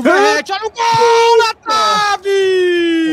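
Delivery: building to a crying shout; explosive goal commentary. Acted. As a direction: rise to a shout at the post. Radio chance on goal.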